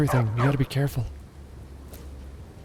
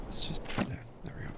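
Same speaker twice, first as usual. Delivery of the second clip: ordinary talk, nothing marked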